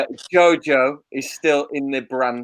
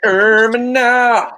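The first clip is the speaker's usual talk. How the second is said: mock echo; 8 semitones higher, 5 dB louder, much slower than usual. Mock echo of bad line.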